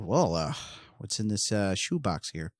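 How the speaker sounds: lightly voiced cop finding the shoebox; a hint of a dazed cop. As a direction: mumble it like a cop poking through evidence. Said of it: "being the cop on the scene".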